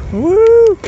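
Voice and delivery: loud, high whoops of joy, slow and stretched; real thrill, whooping at the top of your lungs; excited shouting. Real, not acted. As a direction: yell it out like you're flying downhill. Joyful shouts riding downhill.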